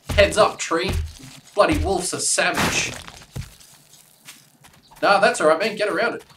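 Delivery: loud, high hectic callouts; excited shouting. Real, not acted. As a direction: shout it in the heat of battle. Fighting wolves, shouting.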